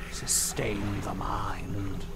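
whisper. Acted. Reading creepy figure's words aloud.

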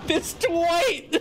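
laughing hard, high and breaking. Real, not acted. Laughing at a teammate's blunders, high and helpless. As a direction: crack up helplessly at the clumsy teammate.

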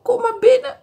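put-on high old lady voice; storybook old lady inviting him in. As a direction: voice a sad old woman, high up. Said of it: "zei de oude vrouw".

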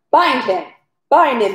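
moderately forceful narrated shout; shouted order. A narrator's reading. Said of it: "he shouted".

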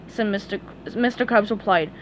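ordinary delivery

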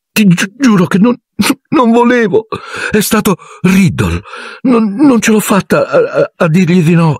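put-on high, halting girl's voice, stammering through tears; a frightened girl confessing between sobs; tearful young girl. A narrator's reading. Ginny confesses to Harry, high and tearful, stammering. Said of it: "le lacrime cominciarono a rigarle il viso".

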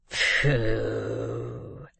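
low, long-drawn dismissive puff; scornful. A narrator's reading. Old man brushing off the girl, scornful.

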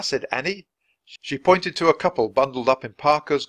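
usual speaking voice

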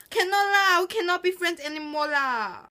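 high-pitched Singlish pout; sulky Singlish with lah. Shown as a demonstration. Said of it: "'cause I can speak Singlish".

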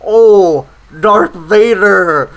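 hammed-up swooning over the name; mock fanboy villain. Mocking the dorky villain who worships Darth Vader, slow dreamy swoon.

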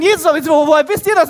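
high, loud baffled question; excited shouting. Acted. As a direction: yell it in flustered excitement. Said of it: "Und sie schreien".

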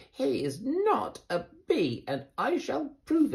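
insist, high and sure; high determined Amelia. Acted. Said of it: "said Amelia".

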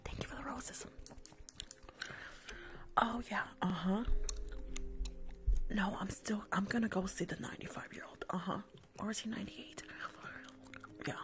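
breathy whispered roleplay throughout. Acted. Nail tech gossiping on the phone at work, whispered.

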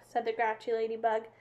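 ordinary delivery

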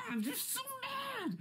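high laughing squeal. Real, not acted. Outro after the rant.

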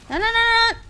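high, loud panicked no's; sudden alarmed cry; panicked shriek. Real, not acted. Panicking in the game.

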